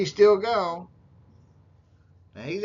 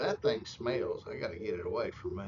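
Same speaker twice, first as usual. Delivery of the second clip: imitated grumble; 7 semitones lower, 8 dB quieter than usual. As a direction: grumble it low, as if edging away. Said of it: "Dan moves his chair and goes".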